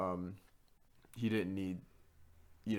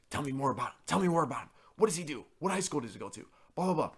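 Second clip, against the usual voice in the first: higher, nagging questions; mock insistent RA; 5 semitones higher, faster than usual. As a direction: imitate a pushy official. RA's insistent questioning mimicked.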